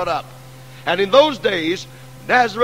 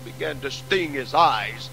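hushed sermon narration; 5 semitones lower than usual. Acted. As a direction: draw the congregation in close with a falling voice. Describes crucifixion, voice sinking.

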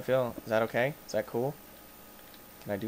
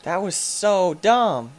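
loud, high, stretched "so dumb"; emphatic exasperation; 7 semitones higher, 7 dB louder, much slower than usual. Real, not acted. Gamer looking back on a near death, emphatic drawn-out exasperation. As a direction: drag out "so dumb" with force.

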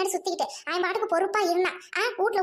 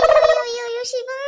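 loud hammed-up wail of grief; mock wailing; 4 semitones higher, 8 dB louder than usual. Mother wailing loudly over her hurt son. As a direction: wail the son's name like a mourner.

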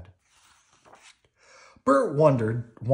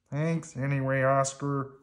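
downcast puppet voice, held level and higher; dejected; 5 semitones higher than usual. A narrator's reading. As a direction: turn down kindly, let down. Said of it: "Bert sighed".

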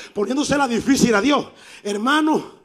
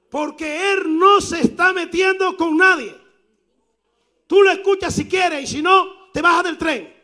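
put-on young man shouting; 6 semitones higher than usual. Young man defends the preacher.

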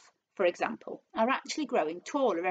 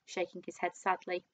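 ordinary delivery

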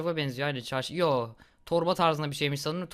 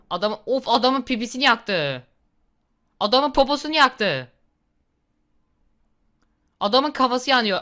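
higher, louder excited commentary; real excited gamer reaction; 6 semitones higher, 6 dB louder than usual. Real, not acted. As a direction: cry it out thrilled at the carnage. Excited reacting to burning man.

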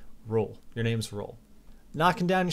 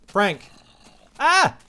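loud, high cries for Frank; alarmed yell; 7 semitones higher, 9 dB louder, much slower than usual. Real, not acted. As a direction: shout in panic at the pet. Pet in danger in game.

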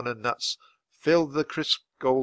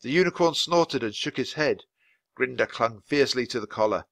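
ordinary delivery

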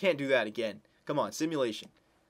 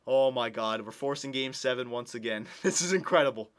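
ordinary talk

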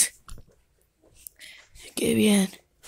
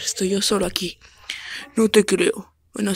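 ordinary talk, nothing marked

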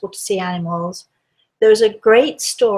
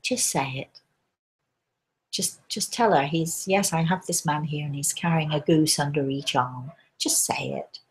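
low hushed whisper of a guiding spirit, softer than her talk; Grey Eagle; 3 semitones lower, 5 dB quieter than usual. Retold or imitated. Spirit guide urging the medium to just say it, whispered softly. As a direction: breathe the urging words like a quiet inner voice. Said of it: "Grey Eagle said to me".

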